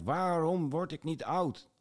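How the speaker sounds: put-on old soldier crying out why he can't age; desperate cry. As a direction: cry out to the sky in despair. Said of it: "En hij schreeuwt".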